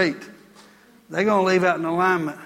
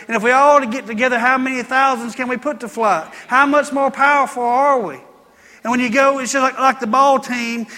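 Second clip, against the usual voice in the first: driving, raised pulpit cadence; preaching at full force; 5 semitones higher, 6 dB louder than usual. Acted. Pastor rallying the congregation in full preaching voice. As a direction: preach it out to the whole room.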